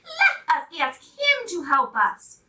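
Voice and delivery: rally the toys to ask; eager clown voice. A narrator's reading. Clown urges the toys to ask for help. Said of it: "said the funny little clown".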